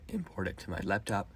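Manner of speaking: normal talking voice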